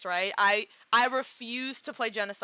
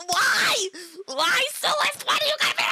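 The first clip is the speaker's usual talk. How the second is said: anguished crying shout; 14 semitones higher, 6 dB louder than usual. Real, not acted. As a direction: cry out high and loud in real grief. Distraught over character's death.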